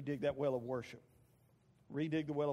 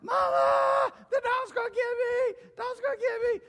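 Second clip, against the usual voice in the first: put-on high, loud screaming kid fleeing a dog; panicked little boy; 12 dB louder, slower than usual. Little boy chased by a chihuahua screams for his mother, panicked. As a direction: holler for mama in a hammed-up shrill panic. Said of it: "he was running from this dog, going".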